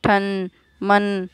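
ठन, मन spelled out very slowly, each nasal held; slow syllable drill. Shown as a demonstration. Teacher drilling ठन and मन very slowly for children. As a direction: sound out ठन and मन slowly for the class. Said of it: "देखो किस तरह से अनुस्वार लगने पर".

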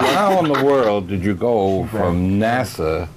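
ordinary talk

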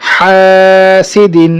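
"হাসিদিন" with long held "হা", short endings; stretched reading. Shown as a demonstration. Teacher joining syllables for students, "হা" drawn out. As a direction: sustain the first vowel of "হাসিদিন". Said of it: "টানা পড়ে চলে যাবো".

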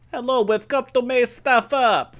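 slow, loud mock Russian accent. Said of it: "Why'd I have a Russian accent?".

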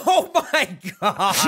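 drawn-out laughing disbelief. Real, not acted. Friend hears about the viral trailer comment, laughing. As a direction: say it slowly through laughter. Cracks up at the likes count.

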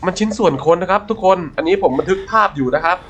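ordinary talk, nothing marked

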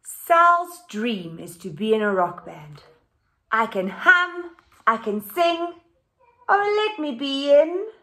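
animated picture book read-aloud; reading to children. A narrator's reading. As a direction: read it out lively for the class.

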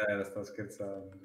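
teasing slow clown tone; joking, mocking clown voice; clownish tone. Real, not acted. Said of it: "con quel tono da pagliaccio".

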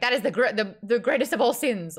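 loud, high mock indignation; mock outrage. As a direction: imitate a scandalized character, loudly. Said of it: "She's like".

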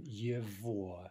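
его said with its g as a v; teacher showing the v sound in его; pronunciation demo. Shown as a demonstration. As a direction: carefully model его with a v sound for the g. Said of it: "Note the pronunciation".

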